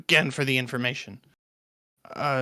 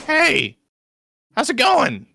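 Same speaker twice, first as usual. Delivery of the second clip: friendly traveler voice; 9 semitones higher, slower than usual. Acted. Character greets farmer.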